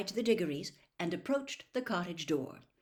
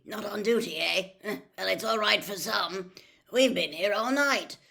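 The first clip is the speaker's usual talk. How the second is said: a weary official, louder, half laughing; grumpy tired wizard; 6 dB louder than usual. Acted. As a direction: grumble at the lucky off-duty colleague. Basil grumbles, chuckling.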